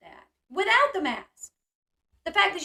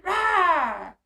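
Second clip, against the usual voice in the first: imitated scream; 4 semitones higher, 7 dB louder than usual.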